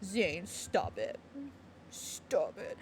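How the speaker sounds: slow, soft, nearly whispered stop it; hushed, embarrassed scolding, slow; flustered scolding. Real, not acted. Flustered by character's line.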